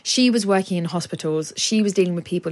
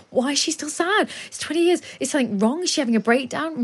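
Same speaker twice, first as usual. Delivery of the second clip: higher, alarmed mock voice of coworkers; imitating panicked colleagues; 7 semitones higher than usual. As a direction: play the puzzled coworker, pitched up.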